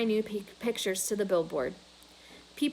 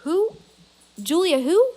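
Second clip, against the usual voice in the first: puzzled; 9 semitones higher, 7 dB louder than usual. A narrator's reading. Mac puzzled on the phone.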